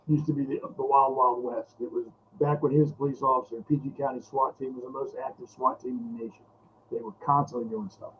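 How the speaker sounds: ordinary talk, nothing marked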